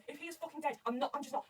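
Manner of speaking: usual speaking voice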